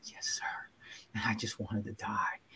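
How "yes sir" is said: very quiet, near-whispered obedience; hushed, resigned reply; meek compliance. Retold or imitated. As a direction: give in under your breath. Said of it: "and I just said".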